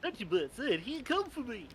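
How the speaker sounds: put-on high, sweet anime girl voice; squeaky cartoon anime girl; high cute anime girl. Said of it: "Do it in an anime voice".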